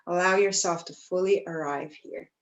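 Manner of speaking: normal talking voice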